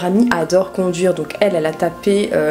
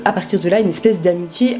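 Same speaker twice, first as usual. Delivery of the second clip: ordinary talk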